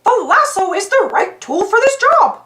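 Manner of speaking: high, cheerful mouse voice; bright and eager, like a cartoon hero; Mickey voice. A narrator's reading.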